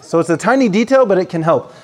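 normal talking voice

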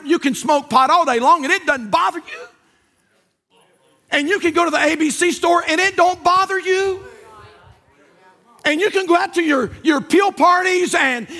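high, driving revival sermon; full-force preaching. Real, not acted. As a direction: preach it hard, building to a final shout.

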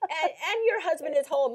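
normal talking voice

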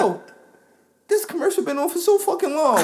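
high-pitched retelling breaking into laughter; imitated laughing outburst. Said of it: "she just busts out laughing".

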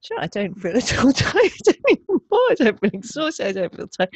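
bright astonished joy; surprised relief. Retold or imitated. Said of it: "I was like".

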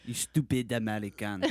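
hammed-up French accent, sneering; mock French accent. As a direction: play a rude Frenchman in a bad French accent. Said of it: "bad French accent".

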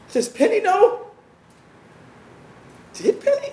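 high, strained panic; panicked. Acted. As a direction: voice jumps up in fear. Kurt panics over the body.

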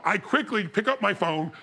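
usual speaking voice

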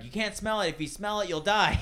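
dramatic warning. Retold or imitated. Quoting comedians' joke.

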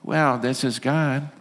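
mock-mild, drawn-out voice of God; underwhelming God. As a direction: play God slow and unimpressive, no thunder. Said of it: "it's not like".

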